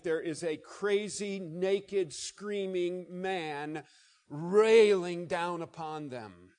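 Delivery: slow, heavy build to a loud cry; slowing down and swelling for the madman; dramatic emphasis. Real, not acted. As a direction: paint each word slowly, then hit railing hard. Vivid slow build-up of scene.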